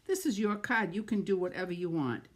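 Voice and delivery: ordinary delivery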